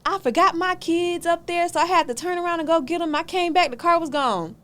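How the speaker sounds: mock her lame excuse, high; mimicking her excuse. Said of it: "this was her excuse".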